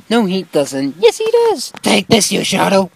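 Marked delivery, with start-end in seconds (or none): 1.0-1.7 high character voice. Acted.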